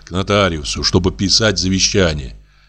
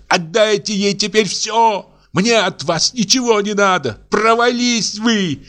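much higher, furious accusing rant; Аксинья кричит; 11 semitones higher than usual. A narrator's reading. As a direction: denounce them all. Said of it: "продолжала Аксинья кричать". (гораздо выше, яростные обвинения)

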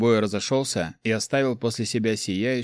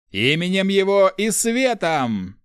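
high, loud, slow war cry; battle cry; 10 semitones higher, 5 dB louder, slower than usual. Acted. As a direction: call it out across the battlefield.

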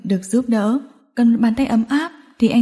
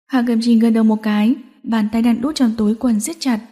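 ordinary delivery

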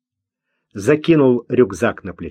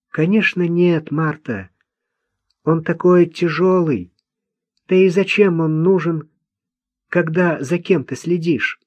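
put-on higher-pitched character voice for a simple young man; naive Kliman, protesting; 6 semitones higher than usual. Simple man explaining himself to the old woman, naive protest. Климан answers Марта in narrated dialogue.

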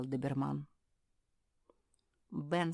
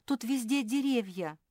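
raised, fretful voice; high, louder, uneasy; nervous; 8 semitones higher, 5 dB louder than usual. A narrator's reading. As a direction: fret about being lost. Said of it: "занервничала Жози".

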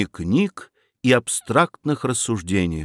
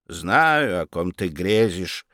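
low, softer offended tone; 7 semitones lower, 3 dB quieter, slower than usual. A narrator's reading. Aunt answers nephew, low and offended. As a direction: say it with pursed lips, hurt. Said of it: "обиженно поджала губы".

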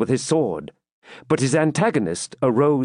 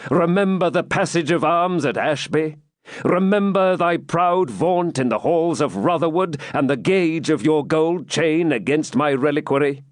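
higher, rising, proud challenge; defiant knight; 5 semitones higher than usual. Acted. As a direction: throw the taunt in his face. Ivanhoe taunts the Templar.

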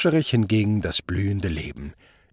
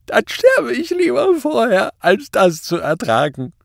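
loud, very high, wailing despair; unrestrained weeping; 14 semitones higher, 6 dB louder than usual. A narrator's reading. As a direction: wail it out through tears. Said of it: "weinte hemmungslos". (hemmungslos weinend)

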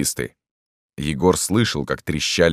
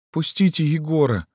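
put-on pleading girl; 12 semitones higher than usual. A narrator's reading. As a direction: ask in a girlish raised voice. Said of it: "попросила Люська".

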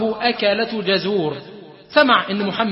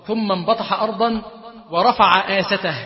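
usual speaking voice

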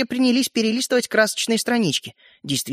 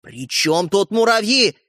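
slow outrage climbing higher; rising to a shout; 7 semitones higher, 3 dB louder than usual. A narrator's reading. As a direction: build from indignation to a raised-voice accusation. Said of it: "перешёл на крик Родька". (срываясь на крик)

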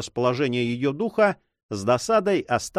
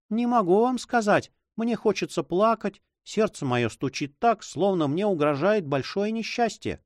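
put-on higher, uneasy girl's voice; anxious girl; 7 semitones higher than usual. A narrator's reading. Girl telling her guardian her heart is pounding, anxious. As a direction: voice pitched up, close to tears. Juana near tears.

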